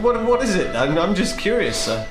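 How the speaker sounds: ordinary talk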